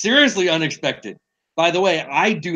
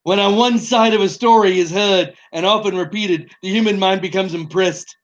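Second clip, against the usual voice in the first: higher, hammed-up politician voice; president impression; 5 semitones higher than usual. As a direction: play a grand old president. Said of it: "we should do an impersonation game".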